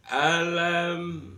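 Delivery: Alam with the m said clearly and fully closed; clear m sound. Shown as a demonstration. Teacher showing students a clear m. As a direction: hold the m in Alam crisp and distinct. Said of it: "Mimnya dibaca jelas".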